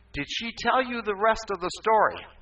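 hammed-up loud questioning; imitated indignant mother. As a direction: demand it as the acted mother.

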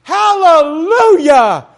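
long, high, loud hallelujah cry; joyful shout. Real, not acted. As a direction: throw it out with full joy. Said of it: "you know what I want to say".

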